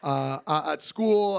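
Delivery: normal talking voice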